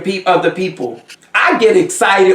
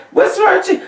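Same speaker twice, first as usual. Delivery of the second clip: indignant question; 10 semitones higher, faster than usual. Real, not acted. Indignant retort.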